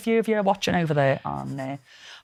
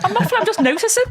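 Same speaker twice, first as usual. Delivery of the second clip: laughing; 10 semitones higher, much faster than usual. Real, not acted. Denies flirting, laughing fast.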